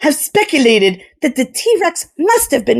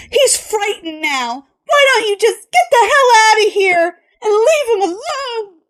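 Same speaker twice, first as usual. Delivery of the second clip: high, tearful angry outburst; furious Kelly; 11 semitones higher than usual. A narrator's reading. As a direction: drive the men out, near tears.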